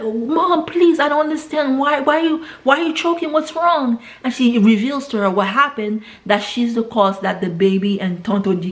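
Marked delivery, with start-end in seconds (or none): 0.0-4.0 high, frantic pleading, mildly acted; retold panicked daughter, pitched high. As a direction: beg your choking mother to tell you what's wrong. Daughter pleading with choking mother.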